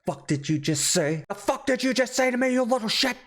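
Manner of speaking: hammed-up outraged wife; mock menacing snap. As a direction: spit it back like a wife suddenly turning nasty. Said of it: "She goes from a pleasant tone to".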